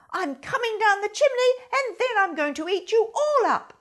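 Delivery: angry threat. Acted. Angry fox threatening the chick, loud. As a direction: menace the chick.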